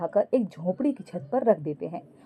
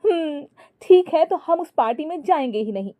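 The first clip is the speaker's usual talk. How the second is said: high, sulky angry retort; wife snapping at her husband in anger; 6 semitones higher than usual. A narrator's reading. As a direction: snap back in a huff, rising high. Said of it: "Jully गुस्सा होकर बोली".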